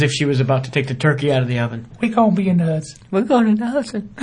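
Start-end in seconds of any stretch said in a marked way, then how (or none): 2.0-4.1 higher, cheerful housewife voice; Midwestern housewife. Retold or imitated. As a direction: sing it out like checking the turkey. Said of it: "like a Midwestern housewife".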